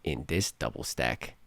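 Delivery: low, soft, slow craving; quiet mumbled order. Retold or imitated. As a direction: mumble it half asleep. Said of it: "I say".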